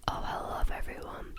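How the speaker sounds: ordinary delivery, nothing marked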